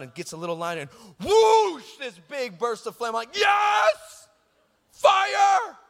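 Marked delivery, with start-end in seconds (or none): 1.2-1.8 very loud, high whoosh of an explosion; imitated huge whoosh. Big burst of flame, imitated whoosh. 3.4-5.8 high, drawn-out retold yell; hammed-up triumphant shout. Boy at the big burst of flame, mock triumphant shout. Retells exulting at huge flame.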